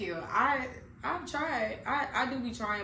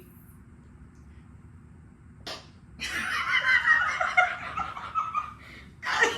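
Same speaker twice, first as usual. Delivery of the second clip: long high, loud cackling laughter; helpless laughing fit; 8 dB louder than usual. Real, not acted. Realizing the ex-friend will watch, helpless high laughter. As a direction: crack up mid-sentence, shrieking with laughter. Cracks up mid-sentence about former friend.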